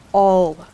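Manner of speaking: "All" loud, low and weighty; great emphasis. A narrator's reading. King looking hard at Alice, "All" heavily emphasized. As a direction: land hard on the single word "All". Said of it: "he repeated with great emphasis".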